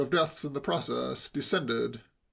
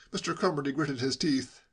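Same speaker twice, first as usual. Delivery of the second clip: ordinary delivery, nothing marked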